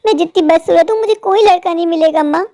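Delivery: usual speaking voice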